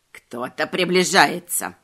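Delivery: low, slow raspy croak; raspy bird voice. Acted. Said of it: "скрипучим голосом сказала птица".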